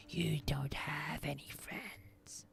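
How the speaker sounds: slow, creepy creature whisper; Gollum whisper. Retold or imitated. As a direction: taunt it slowly under your breath like a creature. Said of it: "like Gollum when he's talking to Smeagol".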